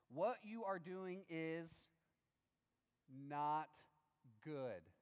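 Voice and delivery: very slow with long pauses before not and good; solemn measured reading; slow deliberate. Shown as a demonstration. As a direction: let each word land heavily. Reading the rebuke for weight.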